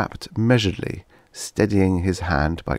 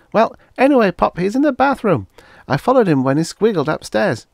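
put-on much higher and louder child's voice; a small boy insisting, bursting with excitement; excited little boy; 6 dB louder than usual. A narrator's reading. Son insisting to his father he followed the Martian, excited child.

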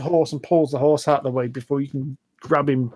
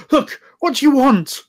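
exasperated high snap; 11 semitones higher, 5 dB louder than usual. Acted. Rider pulls up and snaps at the pushy stranger, exasperated. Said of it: "He goes".

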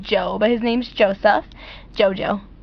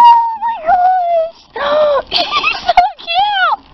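very high, loud, drawn-out squeal; excited squeal; 4 dB louder, much slower than usual. Real, not acted. Shrieking to viewers over the cute new husky, ecstatic. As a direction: squeal with pure delight. First sight of the new plush.

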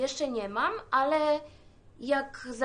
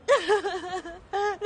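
scoffing laugh; 5 semitones higher than usual. Acted. Scoffing laugh after rant.

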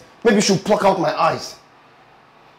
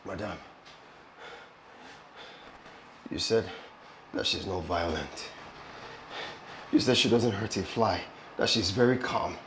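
low, soft, halting voice; weak reproach; 8 semitones lower, 14 dB quieter than usual. Acted. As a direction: reproach her quietly, with long pauses. Injured man reproaching madam from bed.

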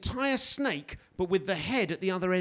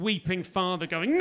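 ordinary delivery

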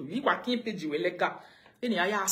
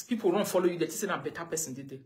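ordinary talk, nothing marked

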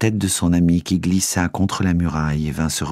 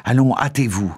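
hurrying call; 6 semitones higher than usual. A narrator's reading. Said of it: "cria le geôlier".

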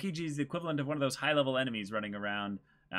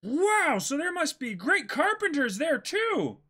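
much higher, louder delighted voice; thrilled at the news; amazed young pirate; 13 semitones higher, 5 dB louder than usual. Acted. Reads Luffy's lines.

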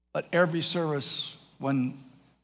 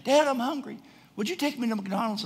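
lightly voiced higher-pitched little boy; pleading kid; 7 semitones higher than usual. Young son to dad watching the game, pleading. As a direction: imitate a child pestering a parent.

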